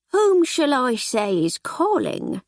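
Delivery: slow, haughty formal voice; prim housekeeper. Acted. Housekeeper coolly addresses the constable, prim. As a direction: look down your nose at him.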